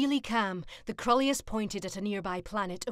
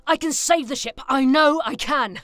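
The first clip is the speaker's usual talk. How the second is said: determined shout; 6 semitones higher, 13 dB louder than usual. Acted. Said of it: "the pilot was determined".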